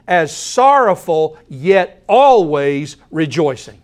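slow, weighted reading with stress on always; slow emphatic quote. Shown as a demonstration. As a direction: dwell on "sorrowful" and lean on "always". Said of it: "listen to this balance".

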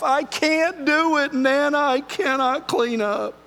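put-on high, slow whining child; whiny four-year-old. A grandfather imitates his granddaughter refusing to clean up, high and whiny. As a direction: plead like a little girl who won't tidy up. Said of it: "It's exactly the way she said it".

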